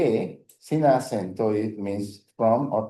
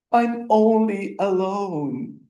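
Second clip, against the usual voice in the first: higher, slow, lightly acted sad answer; teacher playing the lonely one, higher and slow; mock sad reply; 8 semitones higher, slower than usual. Shown as a demonstration. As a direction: answer like a sulking sad friend. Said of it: "What if I want to say".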